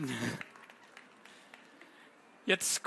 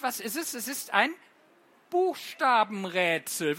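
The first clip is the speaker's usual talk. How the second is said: plain reading voice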